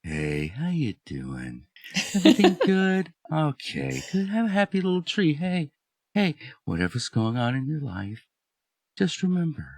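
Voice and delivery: soft, hushed, soothing painter; quiet, mellow reassurance; Bob Ross. Acted. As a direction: speak gently and unhurriedly, as if calming a viewer. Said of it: "Bob Ross is real, like".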